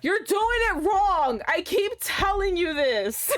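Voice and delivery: high, slow exasperated scolding; you keep doing it wrong, high and drawn out. Real, not acted. Scolding the game character.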